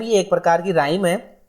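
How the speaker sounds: ordinary delivery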